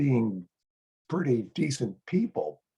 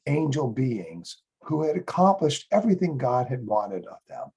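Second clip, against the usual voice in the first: ordinary delivery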